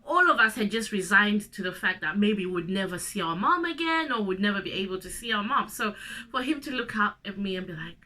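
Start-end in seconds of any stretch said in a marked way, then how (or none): none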